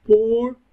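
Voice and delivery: امبورتنت broken into three separate beats, high and loud; a teacher splitting امبورتنت into its syllables. Shown as a demonstration. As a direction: sound out امبورتنت in three pieces with gaps. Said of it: "فبتتنطق من تلات مرات".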